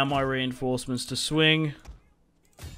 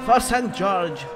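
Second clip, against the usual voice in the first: battle cry; 6 semitones higher, 5 dB louder, slower than usual. Pretend.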